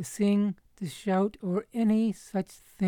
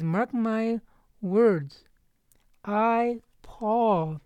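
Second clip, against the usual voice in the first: "I" and "Paul" each set apart and raised; double emphasis; 4 semitones higher, much slower than usual. Acted. Paul asserts himself to the disciples, "I" and "Paul" doubly emphasized. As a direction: land separately on "I," then "Paul". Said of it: "it's double emphasis".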